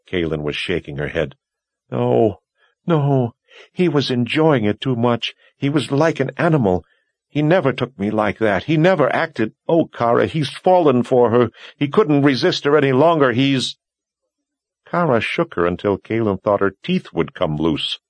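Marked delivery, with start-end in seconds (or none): 1.9-13.8 higher and louder, near hysterical; distraught, building toward tears; rising distraught. A narrator's reading.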